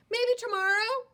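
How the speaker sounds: very high, loud hopeful bird. Acted. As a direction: chirp it hopefully. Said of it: "bird says to the worm".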